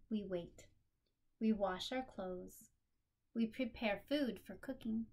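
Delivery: expressive picture-book read-aloud; story narration. A narrator's reading. Reading her picture book aloud to a listening child, expressive storytelling.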